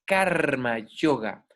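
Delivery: normal talking voice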